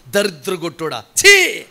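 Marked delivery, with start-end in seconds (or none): 1.3-1.6 sharp high scornful exclamation; disgusted "chee". Acted. Preacher mocking scorners, disgusted "chee". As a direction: spit it out in contempt. Mocking those who spurn you.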